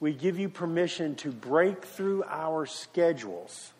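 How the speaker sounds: soft, low prayer with long pauses; hushed prayer, fading to a low amen; closing prayer. Real, not acted.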